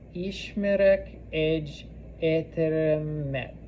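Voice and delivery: word by word, slowly; slow model sentence. Shown as a demonstration. Teacher repeating a Hungarian sentence for learners, slowly. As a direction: separate each word for learners.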